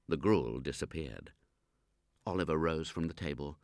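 plain reading voice

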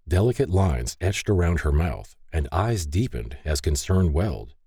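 ordinary delivery, nothing marked

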